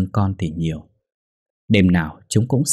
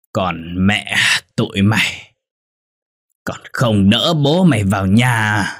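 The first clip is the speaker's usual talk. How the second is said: put-on drunk husband calling; 3 dB louder, slower than usual. A narrator's reading. Drunk husband shouting for help.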